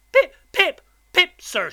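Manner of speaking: high, loud, jumpy repeats; terrified boy. A narrator's reading. Boy repeats his name for convict, terrified. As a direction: squeak it out in panic.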